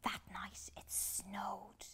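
slow hushed whisper; slow whisper like falling snow. A narrator's reading. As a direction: hush the snowy night. Quiet snowy night.

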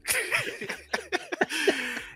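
high wheezy laugh; helpless fit of laughing; real laugh, high and drawn out; burst of laughter. Real, not acted. Bursts out laughing at own disclaimer.

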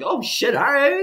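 high, loud retold amazement; pleasant surprise. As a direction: act out being thrilled by a windfall. Retold reaction to extra exchange money.

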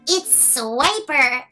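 ordinary delivery

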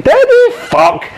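high loud gasp of disbelief; stunned high cry of surprise; shocked. Retold or imitated. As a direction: react in sudden shock. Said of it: "they're like".

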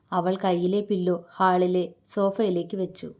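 plain reading voice

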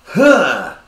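loud high grunt; mock effort grunt. Imitating the slapper's swing, a loud high grunt. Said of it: "Like she".

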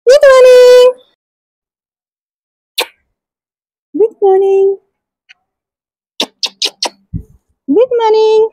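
high excited cooing. Real, not acted. Mother delighted baby is smiling.

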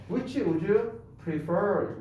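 which would you prefer? spelled out very slowly; slow model sentence. Shown as a demonstration. Teacher to class, slow model sentence. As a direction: drag each word out for learners. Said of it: "which를 써주셔야 되는 거예요".

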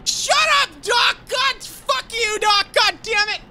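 high, whiny furious cursing; rage-whine at the other player; crying with rage. Real, not acted. Raging at another player.

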